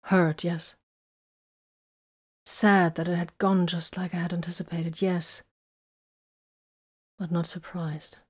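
halting, with a touch of grief; sorrowful. A narrator's reading. Woman confessing her hurt over his attack, sorrowful. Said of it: "she continued in horrible sorrow".

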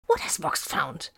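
tense, hissed questioning; urgent demand. A narrator's reading. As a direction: press for an answer over the comm. Solomon urgently questions over comm.

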